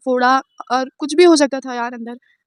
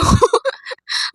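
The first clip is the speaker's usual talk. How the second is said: mock wailing cry; 12 semitones higher than usual. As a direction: ham up a grown man's crying. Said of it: "जैसे मगरमच्छ ... मर्द रो रहा हो". (ऊँची आवाज़ में नकली रोना)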